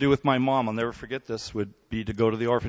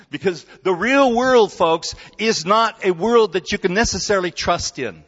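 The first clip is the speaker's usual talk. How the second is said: louder, higher preacher's proclamation; full-force preaching; 7 semitones higher, 7 dB louder than usual. Acted. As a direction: drive the point home from the pulpit. Preaching emphasis.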